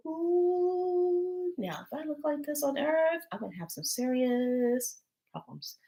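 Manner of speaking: high, delighted ooh trailing into a soft aside; amazed. Retold or imitated. Retelling seeing her reflection, amazed and high. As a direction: marvel at her own reflection.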